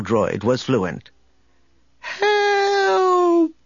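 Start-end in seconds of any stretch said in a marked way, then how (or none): 2.0-3.5 high shrill cry for help. A narrator's reading. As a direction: call out for help in panic. Droid sends distress signal.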